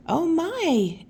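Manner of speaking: high, loud oh my; startled at the early guest; surprised. Acted. Greeting Aunt Astrid who came early.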